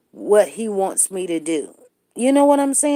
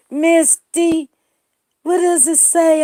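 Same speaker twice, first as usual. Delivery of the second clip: calling out; 7 semitones higher, 5 dB louder, slower than usual. Real, not acted. Calling out to Misty.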